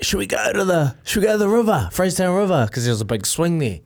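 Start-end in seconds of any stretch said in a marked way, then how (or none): 0.0-2.7 put-on eager kids. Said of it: "my brothers and, and myself were like".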